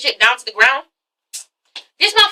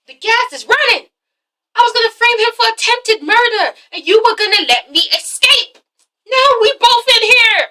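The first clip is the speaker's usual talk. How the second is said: high, urgent rant; pitched-up panicked confession; frantic explaining; 9 semitones higher than usual. Retold or imitated. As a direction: rush it out in alarm.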